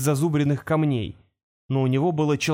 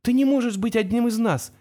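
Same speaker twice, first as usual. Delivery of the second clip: high-pitched, frightened pleading; distressed protest; 9 semitones higher than usual. A narrator's reading. Girl protests to the creature, high and distraught. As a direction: protest in rising panic, far above your usual pitch. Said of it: "странный пугающий звук, что-то между всхлипом и воплем".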